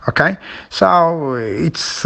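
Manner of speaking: ordinary talk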